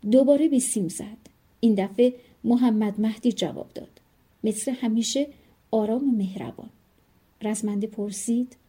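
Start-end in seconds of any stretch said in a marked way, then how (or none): none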